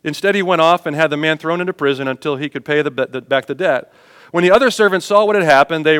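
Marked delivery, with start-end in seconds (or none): none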